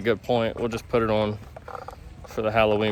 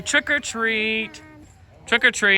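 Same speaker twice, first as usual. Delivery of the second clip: high, slow sing-song prompt; modeling for toddler; 10 semitones higher than usual. Shown as a demonstration.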